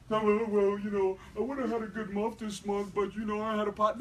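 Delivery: a soft, mock-whiny complaint about a bad month; whiny excuse. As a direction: play the moping crybaby, a touch pitiful. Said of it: "disillusioned crybaby".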